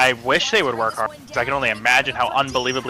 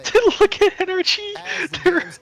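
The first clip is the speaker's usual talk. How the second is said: excited; slower than usual. Real, not acted. Amazed at the team's play.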